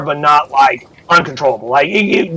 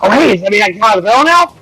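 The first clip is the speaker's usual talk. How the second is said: higher, fast chirpy goblin voice; cheerful goblin; 6 semitones higher, much faster than usual. Acted. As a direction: rattle it off like a carefree little goblin. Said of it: "He goes".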